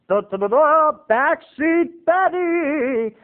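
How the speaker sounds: high, very slow, drawn-out announcer; backseat Betty. Shown as a demonstration. Son naming mom's driving persona, high slow mock. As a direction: ham up the name like a title. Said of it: "she becomes".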